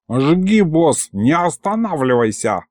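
a hint of a mischievous demon; gleeful cheer, lightly played; lightly voiced cheeky imp, egging on. A narrator's reading. Imp urging his boss to keep going, gleeful.

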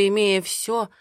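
ordinary delivery

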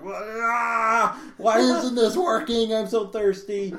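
high, drawn-out mock wail of frustration; hammed-up desperate cry; freaking out. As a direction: whine it loudly like you're losing it. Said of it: "I'm, like, freaking out".

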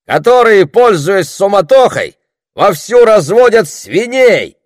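very high, loud heckle; shouted accusation. A narrator's reading. Villager accusing pig breeders before the crowd, shouting. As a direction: denounce them to the whole crowd. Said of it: "вдруг кто-то выкрикнул из толпы".

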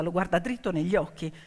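ordinary delivery, nothing marked

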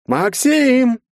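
very high, loud, drawn-out name; singsong call. A narrator's reading. Herzen hunts the hidden boy, singsong call. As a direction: sing the name out tauntingly, holding the vowel. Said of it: "пропел Герцен".

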